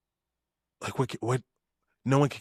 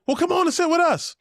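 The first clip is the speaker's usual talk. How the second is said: very high, sing-song friendly voice; mock sweet invite; 5 dB louder than usual. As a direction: play the big guys as surprisingly sweet and welcoming. Big guys' friendly invite quoted.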